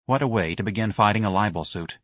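ordinary delivery, nothing marked

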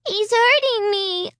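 put-on high, slowed little-girl cry; a toddler whimpering in pain; crying child. A narrator's reading. Little Alicia telling the grown-ups it hurts, crying. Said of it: "Alicia cried".

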